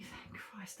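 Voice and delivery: breathless whisper of relief. Retold or imitated. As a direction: whisper it like a private thank-you. Relieved inner thought, whispered.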